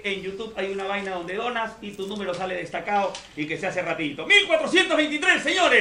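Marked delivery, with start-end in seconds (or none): 4.3-5.8 excited announcing. Real, not acted. Announcing viewer count excitedly.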